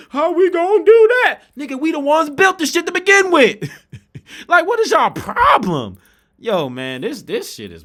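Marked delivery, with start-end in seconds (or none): 0.1-6.0 high, loud, exasperated yelling; rising to a full outraged yell; ranting shout. Real, not acted. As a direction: shout it at the crowd in disbelief. Heated rant reaches its peak.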